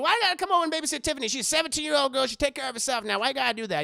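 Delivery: fast, high, loud indignant grandma; mock Madea scolding. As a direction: rattle it off like a sassy old grandma. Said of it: "Madea's retort to Brian".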